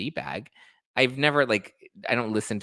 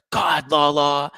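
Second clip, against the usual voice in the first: Sandoval catchphrase, loud; 6 semitones higher, 7 dB louder, slower than usual. Retold or imitated. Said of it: "He always did the".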